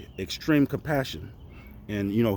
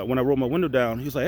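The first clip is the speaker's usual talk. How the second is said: ordinary talk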